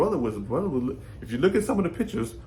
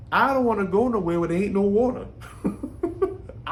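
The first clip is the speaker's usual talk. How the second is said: chuckling through a joke about hell, higher; laughing; 5 semitones higher than usual. Real, not acted. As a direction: laugh the words out, amused. Joking about hell having no water.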